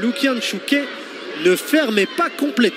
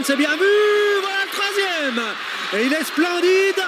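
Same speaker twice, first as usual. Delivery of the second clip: excited; 8 semitones higher than usual. Real, not acted. Third goal scored, commentator erupts.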